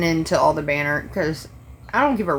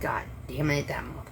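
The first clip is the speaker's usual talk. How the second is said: low, much softer cursing under the breath; muttered cursing; 5 semitones lower, 11 dB quieter than usual. Retold or imitated. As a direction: swear quietly as you turn away. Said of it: "I'm like".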